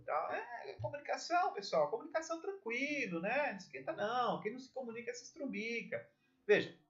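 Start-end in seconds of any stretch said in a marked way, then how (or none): 0.6-6.1 laid-back, higher-pitched careless delivery; relaxed slouch. Shown as a demonstration. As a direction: act totally unbothered, as if nothing matters. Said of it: "todo relaxadão".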